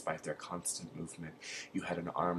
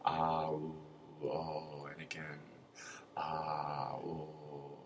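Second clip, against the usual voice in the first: hoarse croaked ah, ooh, oh, fading softer; croaking; 4 dB quieter, much slower than usual. A narrator's reading. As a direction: croak the sounds out like a frozen prisoner, dying away.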